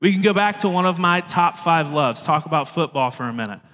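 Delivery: normal talking voice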